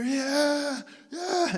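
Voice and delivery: high-pitched whooping yell, imitated; mock cheering. Palestinians cheering from the hilltop, cocky and high. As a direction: cheer like show-offs atop a hill.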